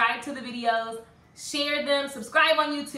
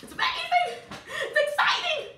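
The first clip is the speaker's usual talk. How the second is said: imagined fans cheering. Retold or imitated. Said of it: "It's like".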